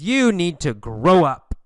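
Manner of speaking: mildly acted stern order, loud on YOU; firm telling-off. Mother ordering her grown sons out, firm. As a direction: tell them off, leaning on the key words.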